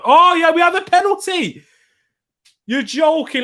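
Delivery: very high, loud surprise; excited shout. Real, not acted. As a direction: burst out at sudden penalty news. Penalty suddenly awarded.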